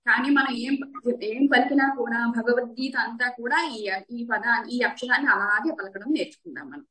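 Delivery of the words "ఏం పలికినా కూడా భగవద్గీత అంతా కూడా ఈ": ordinary delivery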